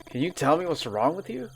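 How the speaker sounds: ordinary talk, nothing marked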